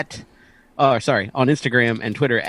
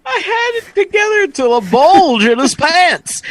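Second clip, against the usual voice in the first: laughing; 7 dB louder than usual. Real, not acted. Cracking up at the read.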